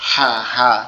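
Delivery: হা pressed out from a tightened throat, loud; demonstrated ha. Shown as a demonstration. Teacher shows students how to say হা, throat pressed. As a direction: squeeze হা out of the throat. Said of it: "গলাটা একটু চেপে আওয়াজ হবে".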